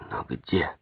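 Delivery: soft, musing question; inner thought. A narrator's reading. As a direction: ask it softly of oneself. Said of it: "мелькнуло у него в сознании". (тихо, как вопрос самому себе)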